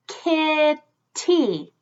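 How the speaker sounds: kitty split in two with a gap; syllable by syllable. Shown as a demonstration. Teacher helping children feel the syllables, split apart. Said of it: "Let's feel the word".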